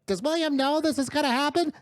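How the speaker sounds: high little imp voice; imp henchman. Acted. Imp questions his boss about the plan, high-pitched. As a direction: ask it as a small, high-voiced minion. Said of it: "the imp goes like".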